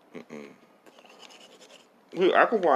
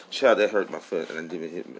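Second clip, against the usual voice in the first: usual speaking voice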